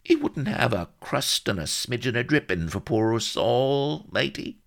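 high, wheedling rustic voice; pathetic begging. A narrator's reading. Solomon begs dripping from Blood, pathetic whine. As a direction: beg scraps like a pitiful castaway. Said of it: "he pawed pathetically at Blood's sleeve".

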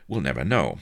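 ordinary delivery, nothing marked

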